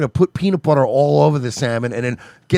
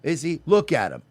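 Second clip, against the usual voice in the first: ordinary talk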